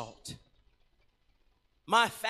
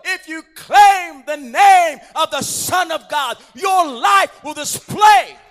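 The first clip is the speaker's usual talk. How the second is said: climactic preaching; 5 semitones higher, 13 dB louder than usual. Acted. Altar call climax.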